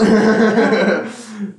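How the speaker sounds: loud, high laugh; short real laughing fit; burst of laughter. Real, not acted. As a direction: crack up at your own retelling. Laughs at repeated invitation.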